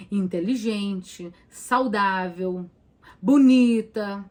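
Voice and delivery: each word of the list said slowly; slow, deliberate list of self-affirmations. Shown as a demonstration. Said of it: "Fala assim". (afirmações lentas)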